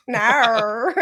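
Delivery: a loud Aussie "No." ribbing friends; hammed-up Australian "No.". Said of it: "when they go and say the word no".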